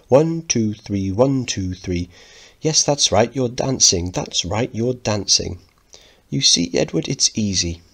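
high, bright encouraging dance teacher; posh mother voice. Acted. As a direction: count the waltz like a fond mother. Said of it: "Missus Lyons speaking".

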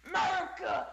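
high mock cheer for America; hammed-up shout of America; patriotic shout. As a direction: bellow it like a proud patriot.

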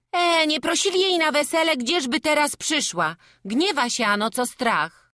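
very high, loud voice; dismissive village gossip. Acted. Villager says the woman was not invited to the wedding, high protest. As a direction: object loudly in a high voice.

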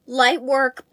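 ordinary talk, nothing marked

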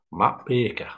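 badly faked Cumbrian accent on the name; mock Cumbrian, BAKER said like "beeka". Shown as a demonstration. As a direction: have a poor go at a Cumbrian accent. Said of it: "That's a bad accent, bad Cumbrian accent there".

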